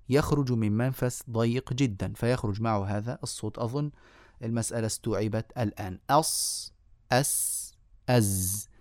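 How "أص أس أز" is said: sad, sin and zay each hissed out alone; hissing letter sounds. Shown as a demonstration. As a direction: give أص، أس، أز as isolated sharp whistling letters. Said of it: "ولا شك أن حروف".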